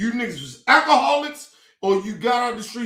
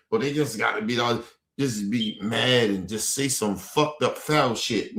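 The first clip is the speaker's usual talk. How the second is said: mocking imitation; 10 semitones lower than usual. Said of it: "they be acting like".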